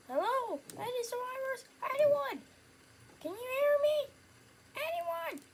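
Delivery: high, loud shouts across empty streets; search party calling. Acted. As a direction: call out for anyone still alive. Searching ruins, calling for survivors.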